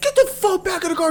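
high barked order; cop yelling. Retold or imitated. Retelling the cop ordering Brian back into the car, high bark. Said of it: "The cop's like".

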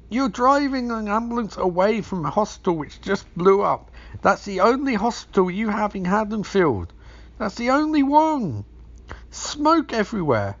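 high, loud exasperated ranting; incredulous rant. Real, not acted. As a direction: shout in disbelief at the screen. Ranting at the movie's absurdity.

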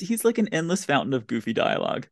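usual speaking voice

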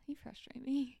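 whispered complaint. Real, not acted. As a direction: breathe it out, no voice.

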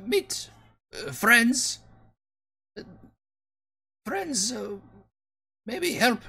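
squeaky broken-speech kobold, halting; high little creature stumbling over words. Acted. Kobold pleading to party, high halting voice.

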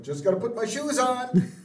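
high, loud imitated holler; calling back. Husband in underwear to waiting wife, calling back. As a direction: shout a breezy excuse to another room. Homer calls back, undressed.

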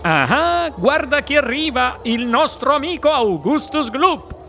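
cheerful Mr Wonka. Acted. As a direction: sell every word like a sweet-maker. Wonka's voice, many lines.

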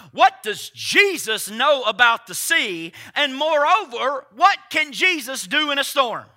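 ordinary talk, nothing marked

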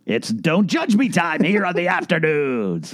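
mock segment announcer. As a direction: draw out a playful segment intro.

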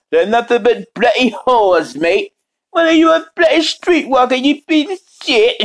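high-pitched British-slang insult voice; Robotnik Cat sixty-nine. Acted. Streamer mocking in a familiar character's voice, high put-on rant. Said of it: "if you hear that voice, what I just did".

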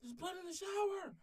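high, loud panicked cry; panicked girlfriend. Retold or imitated. Girlfriend sees blood in the shower, screams in panic. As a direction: shriek it like a frightened girlfriend. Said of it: "she's like".

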